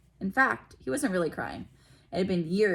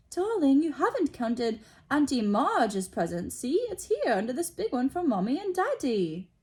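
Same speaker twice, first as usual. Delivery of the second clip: high, louder coaxing mom voice; doting mother; 7 semitones higher, 3 dB louder than usual. A narrator's reading. Aunt Petunia coaxing Dudley, high doting voice. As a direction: coo at the spoiled child.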